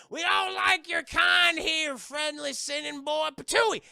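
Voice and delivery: a high, loud, slow shouted rejection and a spit; hostile yell. Acted. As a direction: run him out of town. Tree folk reject Jesse.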